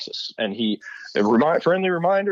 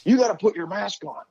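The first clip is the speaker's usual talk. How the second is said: higher, firm official telling him off; stern gate agent; 9 semitones higher than usual. Retold or imitated. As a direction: play the gate agent scolding, pitched up. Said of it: "He's like".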